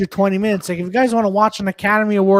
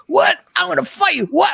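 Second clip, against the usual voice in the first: imitating [name]; 8 semitones higher than usual. [name] squaring up to a fight, high aggressive bark. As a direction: bark it like a rapper picking a fight. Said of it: "[name], like".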